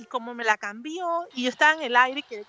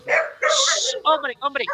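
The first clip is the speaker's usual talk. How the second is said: impatient urging; 3 semitones higher, 4 dB louder, much slower than usual. Retold or imitated. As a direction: hush and hurry him, exasperated. Urging host to hurry.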